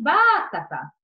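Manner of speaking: batata said as BÁ-ta-ta, first syllable punched; front-stressed batata, as a wrong example; wrongly stressed first syllable. Shown as a demonstration. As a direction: hit the opening BA of batata, then drop away. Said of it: "e nem batata".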